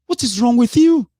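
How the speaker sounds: high, loud, disbelieving yell; frustrated outburst at his own followers; exasperated shout. Real, not acted. Ranting at online followers.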